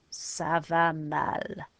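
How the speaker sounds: "Ça va mal" drawn out, mal said mal; slow French ça va mal. Shown as a demonstration. Teacher modelling feeling bad in French, slow. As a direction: stretch "mal" slowly for copying. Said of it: "the word for bad".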